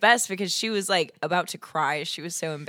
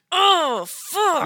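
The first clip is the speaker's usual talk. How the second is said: high, loud mock "oh fuck"; hammed-up pained yelp; pained guy; 12 semitones higher, 6 dB louder than usual. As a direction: yelp it like he just hit himself. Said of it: "and was like".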